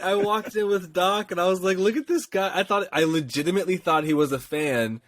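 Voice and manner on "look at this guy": mock scoff. Mocking the stranger in costume.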